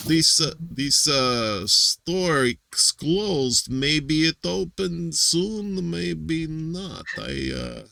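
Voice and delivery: put-on slow, drawn-out Russian accent; lazy Russian accent. Imitating the Russian guy checking closed stores, lazy Russian accent. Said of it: "a lazy Russian voice".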